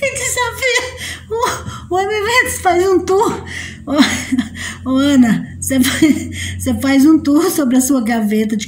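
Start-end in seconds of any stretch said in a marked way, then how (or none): 0.0-3.4 squeaky high voice breaking into laughter; cracking with laughter. Real, not acted. Laughing at her friend, voice squeaks and breaks. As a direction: lose it mid-word, voice cracking up.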